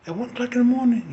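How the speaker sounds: high, loud, fast disbelief; high, fast, loud mock stepmother disbelief; incredulous stepmother. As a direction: shoot it up high in mock outrage. Stepmother questions her suspiciously.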